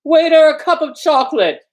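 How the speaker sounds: loud high call across the cafe; shouting. Acted. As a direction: shout the order high and bright. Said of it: "before he shouted".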